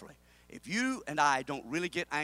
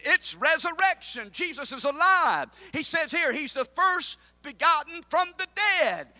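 loud, high fervent preaching; preaching at full force; 9 semitones higher, 7 dB louder than usual. Acted. Preacher to congregation, loud fervent preaching.

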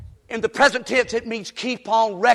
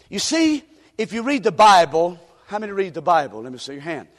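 normal talking voice

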